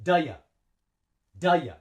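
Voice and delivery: "Da ya" run together with schwa; short "duh-yuh", no gap; linked, one breath. Shown as a demonstration. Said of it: "say it with one breath".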